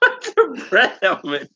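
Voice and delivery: high squeaky laughing question. Real, not acted. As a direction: ask it through a giggle. Repeats question while laughing.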